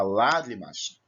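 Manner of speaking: "lágrimas" with a drawn-out, stronger LÁ; stress on first syllable. Shown as a demonstration. Teacher showing the tonic syllable to pupils, "lágrimas" with LÁ held longer. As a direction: lengthen and lean on the LÁ of "lágrimas". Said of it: "você prolonga a sílaba".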